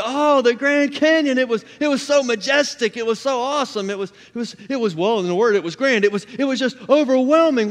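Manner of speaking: high, excited rush of praise; gushing retelling. Retold or imitated. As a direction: rave breathlessly to friends about the trip. Re-enacts his gushing trip report.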